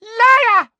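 extremely high, very loud scream; loud furious scream; furious shout. Acted. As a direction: explode at him. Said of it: "shouted Umbridge".

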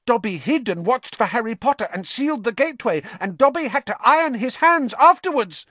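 high, fast, squeaky elf voice; squeaky elf, breathless and eager; eager house elf. Acted. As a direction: rush the confession out proudly. Elf voice held.

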